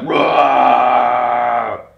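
long, loud, drawn-out "rah" roar; monster roar. Acted. Storyteller acting Leonardo trying to scare people, monster roar. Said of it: "He's like".

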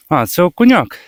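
real burst of happy surprise; pleased surprise. Real, not acted. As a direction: exclaim as you see what you've caught. Angler sees his catch.

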